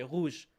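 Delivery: rouge with a short uvular French R. Shown as a demonstration. French speaker showing how they just say it, French R. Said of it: "We just say".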